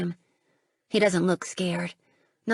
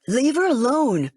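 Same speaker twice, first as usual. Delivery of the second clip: louder, higher protective shout; 5 semitones higher, 6 dB louder than usual. Acted. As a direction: shout across the storm to protect her. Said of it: "I hear Edier shout".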